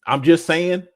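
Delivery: normal talking voice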